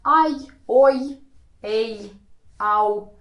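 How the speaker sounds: "ai, oi, ei, au", each as one gliding sound; diphthongs run together. Shown as a demonstration. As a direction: blend both vowels in each of "ai", "oi", "ei", "au". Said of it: "pronounce them together".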